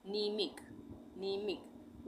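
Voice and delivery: "नीमिक" with no M before the नी; starts on N. Shown as a demonstration. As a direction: model "नीमिक" beginning straight on N. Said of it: "न से शुरू होगा". ("नीमिक", न से शुरू, म साइलेंट)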